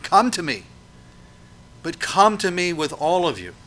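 rising preacher's plea; pleading call. Acted. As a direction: call them in with open arms. God voiced pleading with people.

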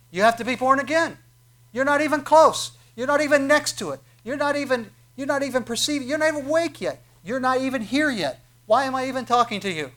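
high, loud, insistent hammering; exasperated preaching. Acted. Jesus telling Nicodemus he's not close, shouting.

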